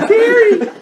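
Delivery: loud, very high cry of "Terry!"; exclaimed name. Retold or imitated. Storyteller crying out the catcher's name, high exclamation.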